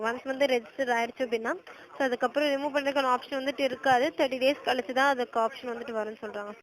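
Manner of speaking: ordinary talk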